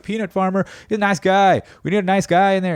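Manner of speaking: mock enthusiastic voter; hyped-up voters. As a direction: gush like a starry-eyed supporter. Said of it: "Obama-esque kind of".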